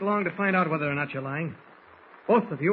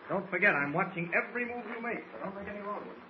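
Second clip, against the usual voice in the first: quiet warning; 3 semitones higher, 9 dB quieter than usual. Acted. Quiet threat while marching them.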